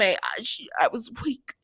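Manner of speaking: mock guilty whisper, breathless and soft; whispered excuse. Husband whispering his weak excuse to his wife. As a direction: hush it like a man caught out. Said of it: "he tried to blame it on Esme".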